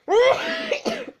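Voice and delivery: helium cough. Real, not acted. Coughing after inhaling helium.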